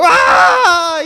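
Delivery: long, high scream of fright; high drawn-out "aaah"; mock scream. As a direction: scream as if thrown into fire. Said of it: "Y gritaría".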